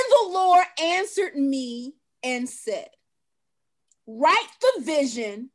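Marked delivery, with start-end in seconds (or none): 4.1-5.5 slow, deliberate Bible reading; scripture reading. Acted. Host reading Habakkuk to her cohost, slowly. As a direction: read the verse slowly, with weight.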